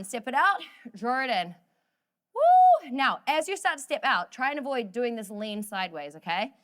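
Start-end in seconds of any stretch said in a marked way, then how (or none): 2.3-2.9 sudden high, loud whoop of joy. Real, not acted. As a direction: cheer them on with a big whoop. Coach whoops mid-exercise.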